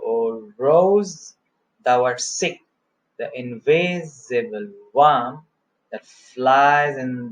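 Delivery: slow verse, weight on ROSE, SICK, WORM, NIGHT; drawn-out stresses on each third syllable; anapestic beat. Shown as a demonstration. Teacher showing an anapestic pattern, slow stressed recitation. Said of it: "following the anapestic pattern".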